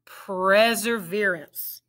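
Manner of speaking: "Perseverance" said smoothly as one word; blended "Perseverance". Shown as a demonstration. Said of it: "Let's blend it".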